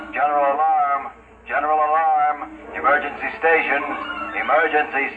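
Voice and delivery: PA announcement. Acted. Ship PA alarm call.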